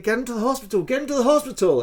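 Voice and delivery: high, hurried call for help; urgent call. Retold or imitated. Bystanders rushing injured Francis to hospital, urgent call. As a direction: imitate bystanders urging a rescue.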